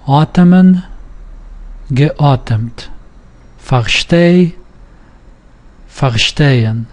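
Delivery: Atmen, geatmet on "at", Versteh, verstehen on "steh"; stem stress. Shown as a demonstration. Teacher reading word pairs for learners, stem stress. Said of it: "it doesn't move".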